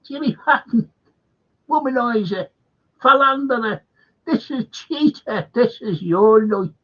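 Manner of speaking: high, loud, slowed-down presenter voice; mock Irish host booming the big reveal; Irish TV host impression. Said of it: "taking off that Irish fella".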